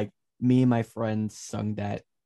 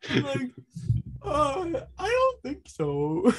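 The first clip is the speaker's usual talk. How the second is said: high, slow, laughing denial; much slower than usual. Real, not acted. A friend denying the obvious while laughing hard. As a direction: squeak it out through laughter.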